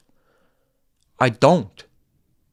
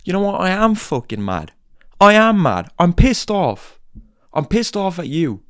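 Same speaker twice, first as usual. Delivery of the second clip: real anger; 3 semitones higher than usual. Real, not acted. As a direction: drive it home, genuinely pissed off. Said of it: "Oh, [name] sounds mad".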